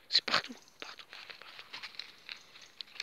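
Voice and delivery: hushed whisper, fading away. Real, not acted. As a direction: breathe the words out in dismay. Whispering in dismay at catastrophe.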